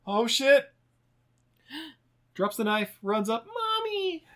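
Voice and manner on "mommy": put-on high child call; little girl. Recapping, a child calling her mom. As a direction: squeak it like a small girl.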